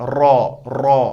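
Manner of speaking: रॉ said with the tongue touching behind the upper teeth; raa from behind teeth. Shown as a demonstration. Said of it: "इनके पीछे से मिलाना है".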